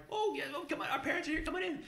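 very high, sing-song hello; tiny Irish girl greeting; little girl voice. Retold or imitated. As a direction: chirp it like a small child.

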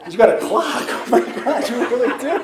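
giggling, pitch jumping up; laughing. Real, not acted. Speaker laughing at the host about the clock. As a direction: laugh it out in disbelief.

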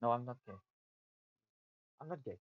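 ordinary talk, nothing marked

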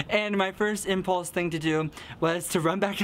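usual speaking voice

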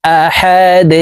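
"আহাদ" intoned with a bounced, echoing d; qalqalah. Shown as a demonstration. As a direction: push off the final d of "আহাদ" like "de". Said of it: "দাল কলকলার হরফ".

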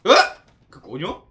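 usual speaking voice

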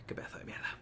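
whispered curse. Real, not acted. Mutters insult at game.

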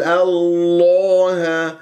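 الله with the long a turned toward o; rounded lips, wrong. Shown as a demonstration. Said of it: "دير بالك تكور الشفاه". (الله بتكوير الشفاه)

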